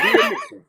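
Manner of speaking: "Readmission" blurted high and loud through a laugh; mother's word, laughing. Retold or imitated. Son laughing retelling his mother's word "Readmission". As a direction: squeal "Readmission" while cracking up. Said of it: "That's how she said it".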